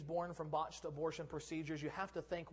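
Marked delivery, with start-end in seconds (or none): none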